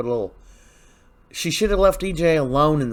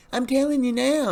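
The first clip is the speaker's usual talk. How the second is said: high Jada voice; 12 semitones higher than usual. Retold or imitated. Jada answering Rafe.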